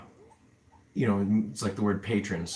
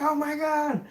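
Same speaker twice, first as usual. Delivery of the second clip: squealed, much higher "oh my God"; excited gasp; 4 dB louder than usual. Retold or imitated. Recalling a new dollar patron, high delighted squeal. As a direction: gush at getting a new patron. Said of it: "I'm like".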